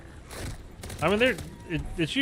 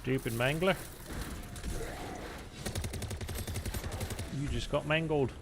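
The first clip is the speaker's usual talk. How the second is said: put-on English accent, rising at the end; amateur stab at an English accent; 3 semitones higher, 8 dB quieter than usual. Shown as a demonstration. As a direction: toss out a mock English accent. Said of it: "That's my English accent for you there".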